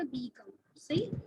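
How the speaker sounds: ordinary talk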